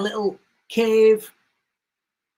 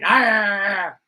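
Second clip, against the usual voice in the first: dog bark. Retold or imitated. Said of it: "a Malinois going".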